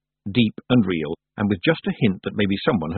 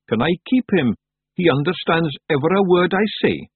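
high Scottish creature begging; eager little Feegle, pitched up; Daft Wooly pleading; 8 semitones higher, 3 dB louder than usual. A narrator's reading. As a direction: plead childlike in a high Scots voice.

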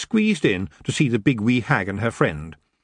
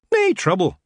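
high Scottish pixie voice; a cheeky wee Scots fairy; Feagle Rob; 9 semitones higher, 3 dB louder than usual. Acted.

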